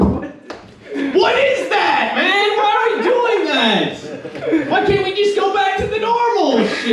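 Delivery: exasperated protest. Acted. Comic protesting weird sex to the crowd, wailing in exasperation. Acted protest within a bit.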